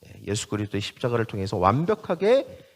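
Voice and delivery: ordinary talk, nothing marked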